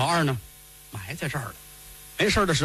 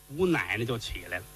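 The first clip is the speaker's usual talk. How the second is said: ordinary delivery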